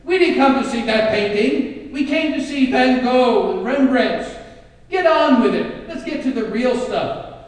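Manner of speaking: loud, high, furious heckling; angry collector shouting down the sale. Acted. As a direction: bark it at the auctioneer, fed up. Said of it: "shouted angrily".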